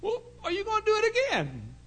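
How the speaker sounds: high, loud mock questioning; mock stern question. Preacher quizzing the boy in a high, loud voice. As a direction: pin the boy down.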